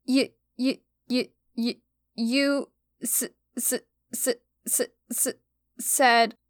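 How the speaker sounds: tearful stutter. Acted.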